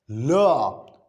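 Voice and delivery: L as "luh", not the letter name; isolated "luh" for L; voiced consonant demo. Shown as a demonstration. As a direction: sound out L with a short vowel.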